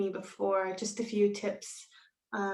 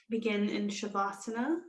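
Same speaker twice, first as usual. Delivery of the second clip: slow, measured yoga nidra guidance with long pauses; guided meditation. Real, not acted. Yoga teacher settling students into Shavasana, slow calm meditation voice. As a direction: ease them into stillness, unhurried and calm.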